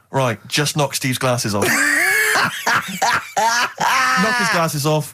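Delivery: barked, slowed-down coaching shout; a judo coach urging the kids to attack, shouting; mock judo coach. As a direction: yell it like a coach egging kids on. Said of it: "the other kids were being taught".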